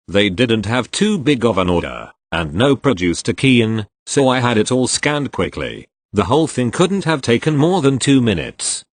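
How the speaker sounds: plain reading voice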